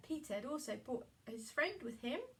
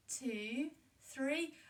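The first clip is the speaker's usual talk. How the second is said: usual speaking voice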